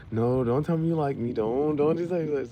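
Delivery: high, loud imitation of her refusal; loud mock protest. Woman fends off a friend's confession, loud high protest. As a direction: cry it out high, mimicking her dismay.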